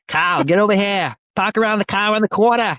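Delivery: high-pitched hammed-up Boston accent. Shown as a demonstration. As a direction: holler it like a Bostonian, dropping the R's. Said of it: "throw a little Boston accent in there".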